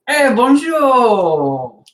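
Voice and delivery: slow, high French bonjour; French greeting. Acted. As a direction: stretch out the French hey bonjour. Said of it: "NPC answers in French".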